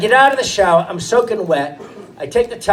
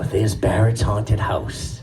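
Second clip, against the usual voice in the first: low, slow, soft creepy reveal; spooky hush; 11 semitones lower, 6 dB quieter, slower than usual. Retold or imitated. Father softly and slowly spooking his boys.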